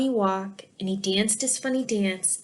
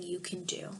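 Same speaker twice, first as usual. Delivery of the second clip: whispered; 5 semitones lower, 14 dB quieter than usual. Acted. Glum man's final words.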